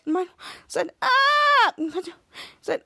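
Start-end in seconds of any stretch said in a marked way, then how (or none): 1.0-1.7 short high mock shriek; retold shriek. Mike shrieks when the TV cuts off, high yelp. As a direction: yelp it in shock.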